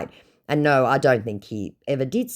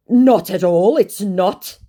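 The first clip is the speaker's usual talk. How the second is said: high, loud scammer denial; mock-protesting voice, raised and emphatic; 8 semitones higher, 9 dB louder, slower than usual. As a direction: deny it as the put-on scammer. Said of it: "he said".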